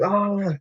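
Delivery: high, mock yell of frustration; retold frustrated shout. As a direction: let out a sharp upset "ah" as if throwing something. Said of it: "like, shouting".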